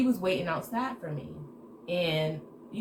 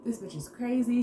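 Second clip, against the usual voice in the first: venting complaint; 6 semitones higher than usual. Retold or imitated. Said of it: "I am bitching".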